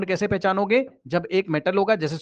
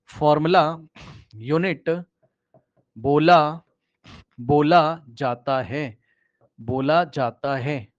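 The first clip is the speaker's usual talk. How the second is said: drawn-out words with pauses, slowing further at the end; slow dictation; 3 semitones lower, much slower than usual. Shown as a demonstration. Teacher dictating the definition for students, very slow. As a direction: dictate it slowly for notes. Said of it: "क्या बोला sir आपने?".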